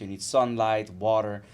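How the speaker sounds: ordinary delivery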